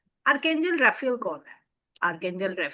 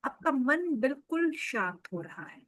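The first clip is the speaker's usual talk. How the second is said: softer, lower, soothing meditation lead; guided meditation; 3 semitones lower, 4 dB quieter than usual. Acted. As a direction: gently guide listeners into deep relaxation, leaving space between phrases.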